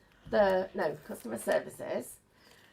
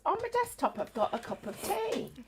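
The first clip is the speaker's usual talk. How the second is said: confused lady caller; 10 semitones higher than usual. Retold or imitated. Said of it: "And she goes".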